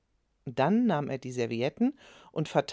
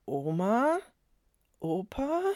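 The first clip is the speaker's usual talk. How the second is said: higher, small uncertain call into the dark; unsure, scared; 5 semitones higher, slower than usual. A narrator's reading. Boy calls his grandparents in the dark, unsure. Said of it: "Bens Stimme klang unsicher".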